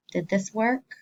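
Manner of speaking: normal talking voice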